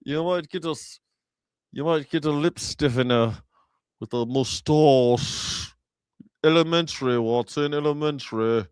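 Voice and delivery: fusty English gentleman voice; mock English accent. Comic playing an English detective, mock English accent. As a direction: keep the lip stiff, very English. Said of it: "the English people, a lot of them speak".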